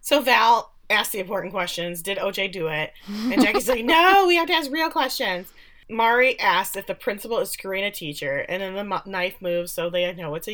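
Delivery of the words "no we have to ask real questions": higher, louder whiny objection; mock exasperated protest. Jackie objects to the silly question, mock exasperated. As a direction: imitate a girl objecting, hammed up.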